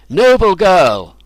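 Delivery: slow, loud called praise. A narrator's reading. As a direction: call after her across the room. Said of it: "I called after Melanie".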